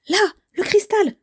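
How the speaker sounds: high, urgent exclamation; excited discovery. Acted. Character spots the stuck crystal. (exclamation aiguë et excitée)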